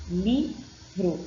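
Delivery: "livro" in two separated syllables. Shown as a demonstration. Teacher demonstrating reading by syllables, "livro" split in two. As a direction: pause between the syllables of "livro". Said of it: "a leitura é feita por sílabas".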